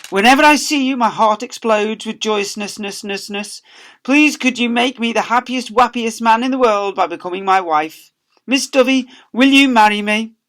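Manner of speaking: high, loud swooning proposal; Mister Lovey proposing; lovestruck proposal. Acted. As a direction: beg her to marry him. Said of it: "proposal to Miss Dovey".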